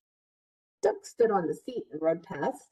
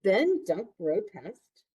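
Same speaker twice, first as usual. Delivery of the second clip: ordinary delivery, nothing marked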